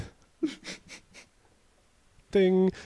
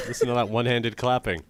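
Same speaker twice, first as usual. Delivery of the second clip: normal talking voice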